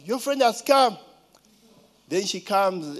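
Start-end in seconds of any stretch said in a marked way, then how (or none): 0.1-1.0 calling out. Retold or imitated. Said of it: "They say".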